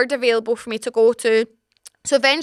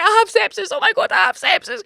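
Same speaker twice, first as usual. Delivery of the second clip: high-pitched hammed-up dread; comic alarm, voice pushed way up; mock panic; 8 semitones higher than usual. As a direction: play up the horror in a raised voice. Retelling her panic over sepsis.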